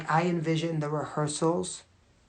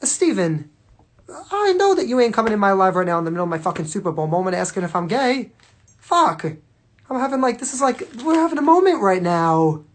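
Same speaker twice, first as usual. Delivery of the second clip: loud, higher, fast indignant rant; exasperated outburst; 4 semitones higher, 9 dB louder than usual. Real, not acted. Telling Steven off for asking mid Super Bowl moment, loud and exasperated. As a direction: blast the interrupting commenter.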